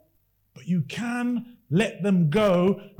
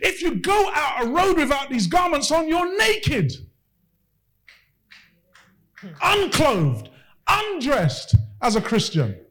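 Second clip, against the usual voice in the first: fiery preaching; 5 semitones higher, slower than usual. Acted. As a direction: preach it with rising fervour. Preaching at full force.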